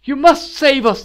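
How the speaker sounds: loud, high-pitched plea; pleading at the top of the voice; desperate cry. A narrator's reading. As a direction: cry out for rescue. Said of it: "the queen cried".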